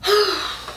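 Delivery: short exasperated sigh. Real, not acted. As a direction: let out an audible breath. Sighs between two retold incidents.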